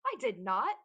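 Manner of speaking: loud, sharp denial; snapped. A narrator's reading. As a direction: snap back defensively. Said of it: "Eric snapped".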